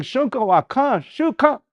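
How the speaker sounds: high clipped fast form; shortened fast slang. Shown as a demonstration. Teacher showing the fast slang version. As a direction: rush the shortened word. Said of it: "everything was real fast".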